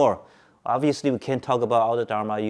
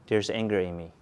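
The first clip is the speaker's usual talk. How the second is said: softer, lower and settled; simply note the anger without owning it; quiet, calm observation set against the outburst; calm acknowledgment; 3 semitones lower, 5 dB quieter than usual. Shown as a demonstration. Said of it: "Do you see the difference?".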